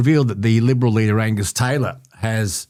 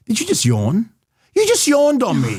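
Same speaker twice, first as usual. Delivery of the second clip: surprised; 10 semitones higher than usual. Real, not acted. Co-host yawned on air.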